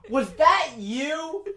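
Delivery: high, drawn-out mock accusation; accusing question. Sister turning on her brother, mock accusing. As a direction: swoop up high on a slow, incredulous question.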